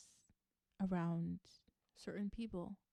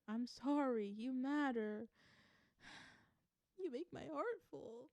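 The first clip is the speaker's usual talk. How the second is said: hammed-up whiny brat, higher-pitched; bratty, emotional channeling; 5 semitones higher than usual. Channeling a partner's heart for the listener, bratty and whiny. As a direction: whine it with exaggerated, swooping pouty emotion. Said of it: "channel those emotions ... giving like bratty energy".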